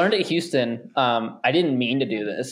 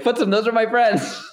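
excited greeting; 9 semitones higher than usual. Retold or imitated. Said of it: "I was like".

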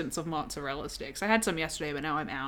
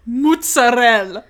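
mozzarella, slow and louder, laughing, in a mocking Italian-American way; a laughing parody of Italian-American mozzarella; 10 dB louder, slower than usual. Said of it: "when people pronounce mozzarella".

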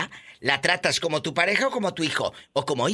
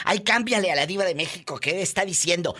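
mocking husbands; 7 semitones higher than usual. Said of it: "Muchos señores que van a escuchar".

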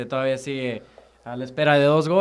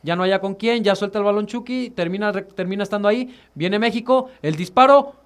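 building play-by-play, higher and louder; breakneck goal commentary; 4 semitones higher, 5 dB louder than usual. Real, not acted. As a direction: race toward the shot, building. Live play-by-play, building to shot.

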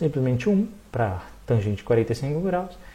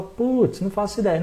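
high, stumped student voice; clueless student; 5 semitones higher than usual. Retold or imitated. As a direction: sigh it like a lost student.

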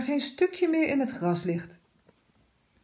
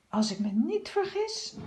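sniffing cat thinking; 8 semitones higher than usual. A narrator's reading. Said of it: "denkt Buitelaar".

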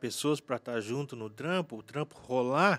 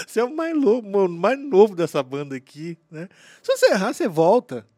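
louder, high, emphatic imitation; animated retold quote; 5 semitones higher, 8 dB louder than usual. Older bandmate urging the kid to take a risk, emphatic imitation. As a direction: push it out with amused insistence. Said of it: "Ele falou assim".

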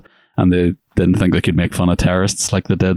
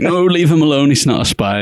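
wife pleading; 8 semitones higher than usual. Retold or imitated. Wife protesting to the guards, high and urgent. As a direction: plead for him in a raised voice. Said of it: "And she's like".

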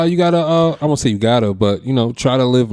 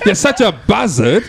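put-on laughing through a Cockney accent; clumsy Cockney accent, pitched high; 6 semitones higher, slower than usual. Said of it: "but you're using a Cockney accent".

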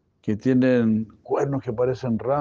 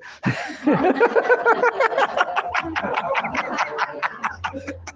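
a long, high burst of laughter at the punchline. Real, not acted. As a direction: crack up at your own joke. Se ríe del chiste. (carcajada larga y aguda al final del chiste)

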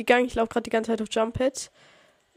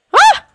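startled scream; 15 dB louder than usual. Real, not acted. Sudden attack in game.

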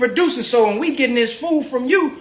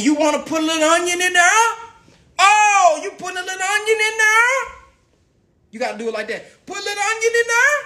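mock home cook; 8 semitones higher than usual. Mock cook adding onion.

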